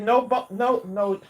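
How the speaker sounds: talking with food in mouth; words round a mouthful; mouth full. Real, not acted. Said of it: "She said what?".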